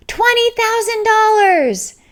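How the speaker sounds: high, loud shouted bid from the crowd; imitating a yelled bid. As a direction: shout the bid across the ballroom. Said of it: "a woman go-, yells out".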